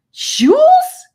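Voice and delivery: shrieked, very high and loud; amazed. A narrator's reading. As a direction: squeal it in disbelief. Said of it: "his eyes widening".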